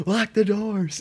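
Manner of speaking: high, loud, drawn-out mock holler; yelling. Storyteller relives warning the house, high panicked yell. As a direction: imitate calling out slowly to someone far off. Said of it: "I'm yelling to the house".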